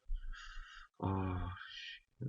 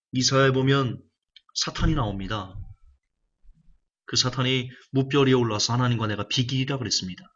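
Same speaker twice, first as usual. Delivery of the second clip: ordinary talk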